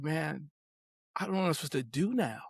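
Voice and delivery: soft, slightly helpless mock sob; understated tearful murmur; mildly tearful retelling. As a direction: reenact a tearful dad, hushed. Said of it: "I'm in the car and I'm crying".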